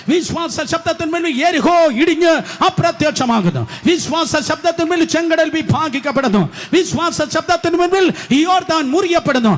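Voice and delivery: chanted litany climbing from steady to a full shout; preach. Real, not acted.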